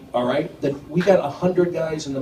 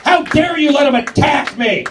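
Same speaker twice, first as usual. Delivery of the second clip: mock outraged shout; 10 semitones higher than usual.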